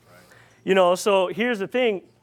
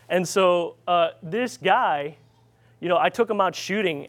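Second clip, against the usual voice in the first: usual speaking voice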